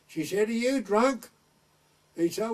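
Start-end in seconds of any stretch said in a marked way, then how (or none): 0.5-1.3 higher, sharp suspicious shout; scolding call. Retold or imitated. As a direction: accuse him from above. Woman scolding from window.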